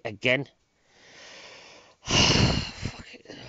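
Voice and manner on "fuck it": a breathy, sighing curse under the breath; exasperated sigh. Real, not acted. Podcaster giving up on his gloomy rant, with a voiceless sighing curse. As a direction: let it out with a weary sigh, almost voiceless. Fed up after ranting about humanity.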